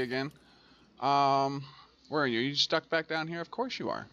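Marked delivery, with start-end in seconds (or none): 3.4-4.1 higher, softer quick aside; resigned. Real, not acted. Player finding the vehicle stuck back down here, resigned. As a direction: toss it off softly on a higher pitch.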